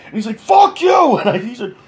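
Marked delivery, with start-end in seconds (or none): 0.4-1.2 very high, loud mock shout; imitating a furious man's shriek; enraged retort. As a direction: hurl it out high and hard. Insulted man curses back.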